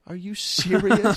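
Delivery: high, loud, drawn-out mock mother; put-on high disbelieving mom voice; imitated incredulous mom. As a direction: stretch it out as a scandalized mom. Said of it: "She's like".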